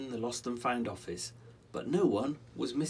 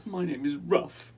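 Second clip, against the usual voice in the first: high squeaky rat voice, sniffing; sniffling little rat; 7 semitones higher, 5 dB louder than usual. Acted. Little rat introducing himself to Rollo, sniffling. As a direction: say it through a sniff. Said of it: "it sniffs".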